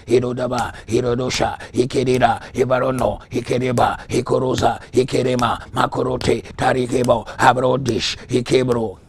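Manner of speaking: loud, rhythmic bursts of tongues; forceful tongues. Real, not acted. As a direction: pound out each word of prayer loudly. Praying in tongues.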